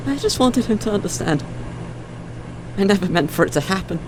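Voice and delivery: very high, distraught voice; pleading remorse, pitch way up; anguished confession. Acted. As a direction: confess it, close to breaking down. Breaking down in confession.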